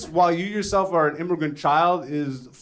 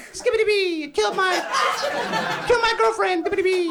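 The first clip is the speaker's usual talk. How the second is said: very high mock nursery-rhyme rap. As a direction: chirp a silly, squeaky rap parody. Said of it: "it sounds like nursery rhymes".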